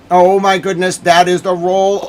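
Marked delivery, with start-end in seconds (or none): none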